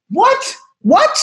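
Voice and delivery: high, baffled double what; stunned disbelief. Retold or imitated. Retelling being thrown out, a high shocked what. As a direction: replay the moment it hit you, shocked. Stunned outside the cookie hut.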